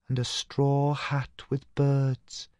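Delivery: high, very slow, airy ghost voice; dreamy young Katinka; clear new voice. A narrator's reading. Dead sister Katinka describes her hat, clear and high. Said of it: "said the clear new voice".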